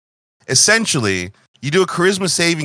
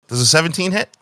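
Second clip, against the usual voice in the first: ordinary talk, nothing marked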